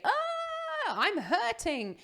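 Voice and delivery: high, drawn-out wailing complaint; mock pain cry. Vocal folds crying out to the brain, mock pain wail. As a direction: wail it slowly with swooping pitch, hammed up. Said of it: "signal back up to our brain to say".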